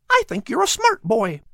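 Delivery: high, chirpy bird voice; a tiny bird listing its visitors; little bird Pip. Acted. Bird Pip answering the cat in a high chirpy voice.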